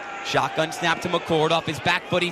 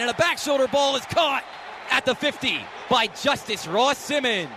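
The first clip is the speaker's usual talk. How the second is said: raised, ringing play-by-play; excited sportscaster calling a completion; excited catch call; 5 semitones higher than usual. Real, not acted. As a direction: lift the pitch as the catch is made. Live call of a big catch.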